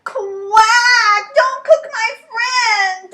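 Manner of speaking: very high, loud quacking yell; desperate shout. Acted. Duck defends Gander from Fox, desperate shout. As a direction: scream to save your friend.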